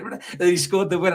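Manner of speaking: ordinary talk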